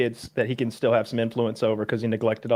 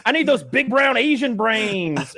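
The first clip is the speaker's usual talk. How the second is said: mocking Elon; 9 semitones higher, slower than usual. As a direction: ham up a smug billionaire brushing off his fans. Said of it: "he's like".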